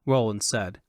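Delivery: ordinary delivery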